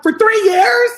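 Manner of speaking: high, loud disbelieving squeal; incredulous. Retold or imitated. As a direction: shriek it in disbelief. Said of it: "And I'm like".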